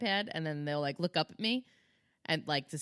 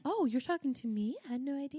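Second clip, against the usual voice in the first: mock innocent kid; 8 semitones higher, 3 dB quieter than usual. Mocking kids who ignore parents.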